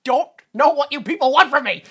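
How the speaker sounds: loud, high, hammed-up helpless protest; mock exasperation. Sheriff answering nagging townspeople, mock exasperation. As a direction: throw it out like you're at your wits' end.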